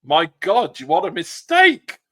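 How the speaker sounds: high, loud mock-aghast outburst; surprised exclamation. Host imitating an actor aghast at a guest's answer, surprised. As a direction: exclaim it like someone can't believe the blunder. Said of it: "surprise and ... exclamation in his voice".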